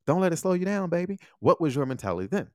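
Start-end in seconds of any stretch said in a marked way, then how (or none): 0.1-1.2 hyped-up pep talk. Retold or imitated. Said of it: "we were like".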